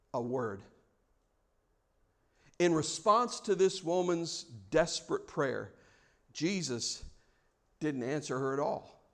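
low, soft, with long pauses; solemn hush, voice sunk low; hushed, grave. A narrator's reading. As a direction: let each phrase drop into silence. Solemn reading of Jesus' silence.

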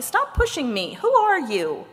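high-pitched, mock-cross challenge; indignant. A narrator's reading. Body challenges the unseen pushers, indignant. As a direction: demand an answer from whoever shoved you.

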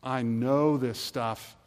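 slow, drawn-out know-it-all; smug dismissal. Retold or imitated. A complacent churchgoer brushing off the Bible, slow and smug. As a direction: stretch it out like you've heard it all. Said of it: "the last one is".

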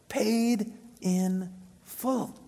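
drawn out very slowly, rising; slow emphatic echo. Acted. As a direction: let each word hang.

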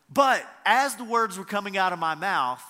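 ordinary delivery

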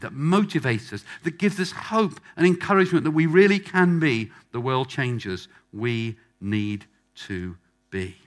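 usual speaking voice

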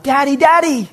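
high-pitched imitation of a child's yell; yelling kid. Preacher translates the kid's cry, high yelling voice. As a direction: call out for dad like a small child. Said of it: "He's yelling out".

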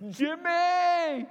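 loud, high desperate call; shouting a name. Retold or imitated. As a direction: yell for help across the boat. Calling louder, no answer.

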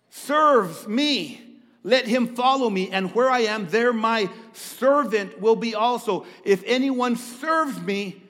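loud, slow, fervent scripture reading. Acted. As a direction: proclaim the verse slowly.

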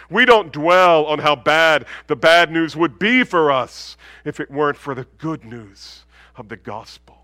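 ordinary talk

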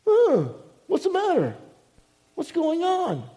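very high-pitched mock protest; complaining attitude. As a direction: imitate an affronted cry of complaint. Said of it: "they have this attitude".

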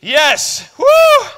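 high, loud whoop of delight; excited cheer. Real, not acted. Preacher whoops after the church's loudest shout. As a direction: cheer like you won.

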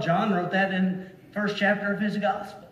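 normal talking voice

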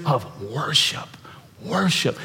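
soft, breath-only whisper; whispered, hushed emphasis. Real, not acted. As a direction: drop to a reverent whisper. Reverent hush on the key word.

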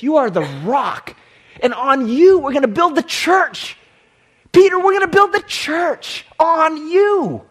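rising to a loud, high proclamation; Jesus proclaiming. Acted. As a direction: build from firm declaration to a ringing call. Preacher voices Jesus commissioning Peter.

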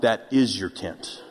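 leader's flat reply. Retold or imitated. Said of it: "To which he responded".

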